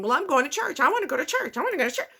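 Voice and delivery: high, repetitive parody of his excuse; mocking imitation. Man justifying himself with church, mockingly imitated in high voice. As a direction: mimic him harping on in a high little voice. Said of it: "he kept justifying it by saying".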